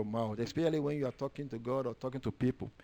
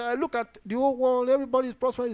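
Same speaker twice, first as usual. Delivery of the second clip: tag complaint; 10 semitones higher, 5 dB louder, faster than usual. Retold or imitated. Voicing David's complaint.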